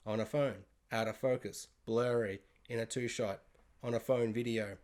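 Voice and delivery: very low, quiet deadpan; hushed, low-pitched disbelief in short phrases; deadpan listing. Real, not acted. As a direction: drop low and soft, item by item. Deadpan listing of underwhelming details.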